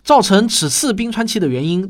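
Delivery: ordinary talk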